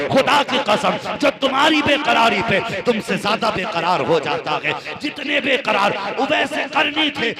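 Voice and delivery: high, loud impassioned storytelling sermon; preaching at full force. Acted. Preacher telling the congregation Uvais Qarni's story, fervent preaching. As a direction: preach it to the crowd with rising fervour.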